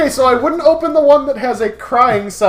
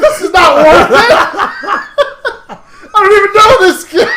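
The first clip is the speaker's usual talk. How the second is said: loud, high, laughing protest; laughing mock outburst; 10 semitones higher, 8 dB louder than usual. Friend balking at babysitting a stranger's kid, laughing loud protest. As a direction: yell it through laughter at being stuck with a baby. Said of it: "I'm like".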